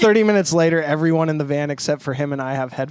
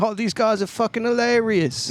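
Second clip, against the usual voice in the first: higher-pitched mock British accent; imitated British fan; 6 semitones higher than usual. Musician imitating overseas fans enjoying sarcasm, mock British accent. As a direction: put on a British fan's voice. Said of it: "British humor, they're like".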